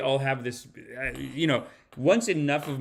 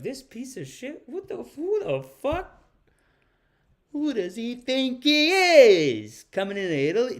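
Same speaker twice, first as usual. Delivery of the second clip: mock outrage; 8 semitones higher, 4 dB louder than usual. Imagining her complaint to the cameraman, outraged. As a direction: start under the breath, then climb into outrage. Voices prime minister's outraged thoughts.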